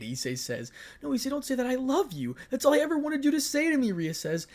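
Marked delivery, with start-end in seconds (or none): 1.0-3.9 high, pleading woman's voice; a desperate girlfriend begs him not to leave. Acted. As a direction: beg him, pitched well up.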